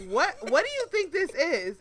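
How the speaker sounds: retold indignant scolding; cussing him out. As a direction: demand it like you're fed up. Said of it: "I'm cussing him out".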